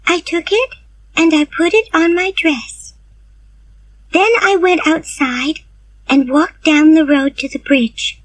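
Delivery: put-on high, eager girl's voice; young Anne. Anne's invented confession.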